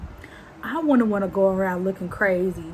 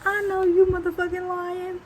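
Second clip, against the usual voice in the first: incredulous call-out; 9 semitones higher than usual. Retold or imitated. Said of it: "And I was just just like".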